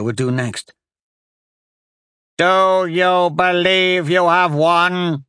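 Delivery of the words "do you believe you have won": slow, high, drawn-out cry; old woman's hateful shout. A narrator's reading. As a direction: hurl a hateful challenge, stretching the words. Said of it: "the old woman shouted".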